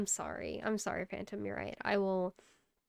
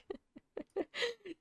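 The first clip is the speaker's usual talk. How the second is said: high laugh; 13 semitones higher, 6 dB quieter than usual. Real, not acted. Laughing at own joke.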